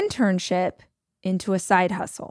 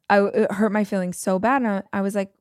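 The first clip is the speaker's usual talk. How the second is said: ordinary talk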